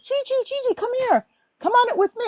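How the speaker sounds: high, eager call across the line; friend calling out. Retold or imitated. Friend Michelle calling her up to ride, high eager call. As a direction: wave her over from the front of the line. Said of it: "she calls me".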